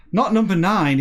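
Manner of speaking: high, loud, panicking plea; panicked shout. Retold or imitated. Barclay begging the computer to stop, shouting. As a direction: yell it before it's too late. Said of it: "he says".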